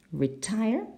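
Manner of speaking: "retire" stressed on the second syllable, rising at the end. Shown as a demonstration. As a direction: lean on the last syllable of "retire". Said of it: "it's the second syllable".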